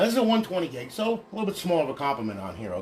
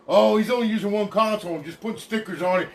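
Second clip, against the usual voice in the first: smartass; 3 semitones higher, 3 dB louder than usual. Retold or imitated. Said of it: "I had some smartass say".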